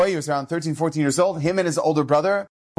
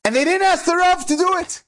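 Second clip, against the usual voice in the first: much higher, outraged question; indignant disbelief; 14 semitones higher than usual. Retold or imitated. Rabbi's circle shocked the rabbi was skipped, high outraged question.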